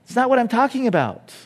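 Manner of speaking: a hammed-up "are you serious" at the disciples; exasperated Jesus. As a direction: playfully voice a fed-up teacher. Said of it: "He's like".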